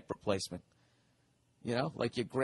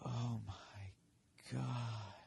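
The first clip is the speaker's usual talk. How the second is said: phony awe; 2 semitones lower, 12 dB quieter, much slower than usual. Retold or imitated. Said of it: "this totally phony".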